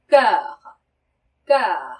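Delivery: cœur said twice, first loud, with rounded lips on an e sound, falling; teacher modelling cœur; French cœur model. Shown as a demonstration. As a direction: demonstrate the vowel slowly twice. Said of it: "이렇게 발음하셔야 되고요".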